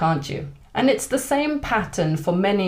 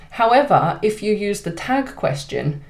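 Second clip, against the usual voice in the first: ordinary talk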